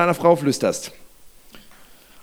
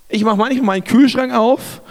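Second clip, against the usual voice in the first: ordinary talk, nothing marked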